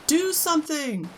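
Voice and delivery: very high desperate order; frantic demand. A narrator's reading. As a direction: scream at him to act. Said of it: "Missus Gloop screamed at Mister Gloop".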